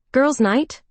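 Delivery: high, bright announcement of plans; announcing. Acted. Sarah announces the plan to Clara. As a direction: declare it like a done deal. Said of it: "she announces".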